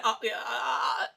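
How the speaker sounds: stammering, panicked imitation; halting uh uh then blurting; mock stammer. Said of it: "didn't catch that stutter".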